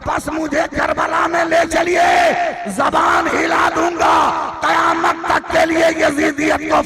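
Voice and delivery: high, loud, slowed preaching shout; fiery proclamation. Acted. Preacher shouting Asgar's vow to the crowd. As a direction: thunder the child's vow. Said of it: "कहा".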